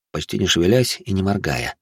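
ordinary delivery

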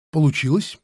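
lively question, lifted at the end; mildly eager, curious question; keenly interested. A narrator's reading. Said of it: "спросил Иван с живейшим интересом".

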